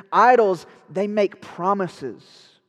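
IDOLS shouted, then slow; preaching, punched. Real, not acted. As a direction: hammer the word out from the pulpit. Preaching emphasis.

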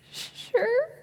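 tiny, very high, soft sob; mock tearful squeak. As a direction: squeak it out as if fighting tears. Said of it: "I'm blubbering".

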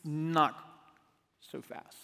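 breathed out almost unvoiced; hushed dramatic turn. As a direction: drop to a near whisper.

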